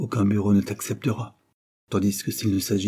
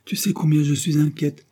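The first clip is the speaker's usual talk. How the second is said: higher-pitched, mildly piqued insistence; slightly vexed; 7 semitones higher, 4 dB louder than usual. A narrator's reading. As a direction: press the point, a little hurt. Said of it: "répéta-t-elle un peu vexée".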